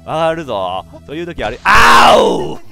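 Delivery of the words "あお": a sharp, loud, high yelp; startled yelp. Real, not acted. Player missing a jump, startled yelp.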